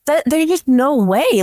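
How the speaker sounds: high, incredulous protest; retold upset disbelief. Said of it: "really upset at that".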